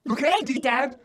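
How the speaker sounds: put-on excited boy, high and loud; child calling. A narrator's reading. Joe to dad about little brother, excited calling. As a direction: call Dad to come and see.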